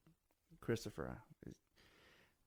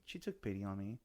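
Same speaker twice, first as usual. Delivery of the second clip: usual speaking voice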